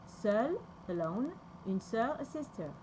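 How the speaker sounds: ordinary talk, nothing marked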